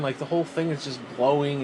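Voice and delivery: usual speaking voice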